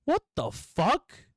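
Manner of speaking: slow, high incredulous question; boss's disbelief. Retold or imitated. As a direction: act a boss stretching out stunned disbelief.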